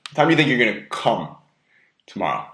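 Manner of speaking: heavy emphasis on "come", pause after it; stress on COME. Shown as a demonstration. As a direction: lean suggestively on "come". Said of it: "put a lot of emphasis on the word come".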